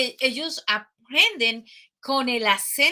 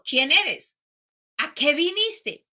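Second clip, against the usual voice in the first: instructor asking; 5 semitones higher, slower than usual. Retold or imitated. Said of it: "te va preguntando".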